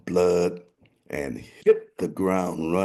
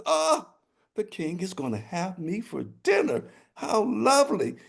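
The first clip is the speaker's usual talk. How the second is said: high, chirpy rooster voice; delighted rooster; 13 semitones higher than usual. Acted. Rooster in the sack relishing dinner with the king, high and chirpy. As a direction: coo over it like a pleased little bird.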